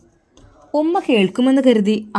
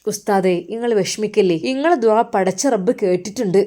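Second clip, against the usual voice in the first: ordinary delivery, nothing marked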